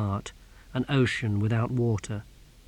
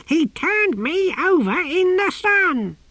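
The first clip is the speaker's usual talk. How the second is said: high, indignant creature shriek; shouting tortoise; 4 dB louder than usual. A narrator's reading. Tortoise complaining to the novice about his tormentor, shouting high. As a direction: shriek the complaint far above normal pitch. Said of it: "shouted the tortoise".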